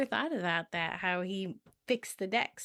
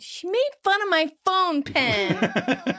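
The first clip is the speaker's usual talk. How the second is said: high, drawn-out mock whine; slow, hammed-up whiny complaint; whining; 6 semitones higher, much slower than usual. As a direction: stretch it into a petulant wail. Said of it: "but then whines".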